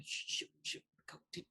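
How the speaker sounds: hushed, breathy shooing; urgent whisper. Acted. Lexa shooing companions away in a whisper. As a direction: whisper them off quickly.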